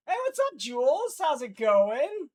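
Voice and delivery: high, bright hello to a viewer; light up to greet a friend; excited greeting. Real, not acted. Greeting a chatter by name.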